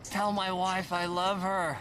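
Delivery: wounded soldier gasping. Pretend. Dramatic mock death scene.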